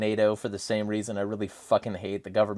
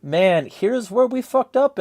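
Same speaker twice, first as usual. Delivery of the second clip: mock regretful official; 12 semitones higher, 5 dB louder than usual. Mock confession of past governments.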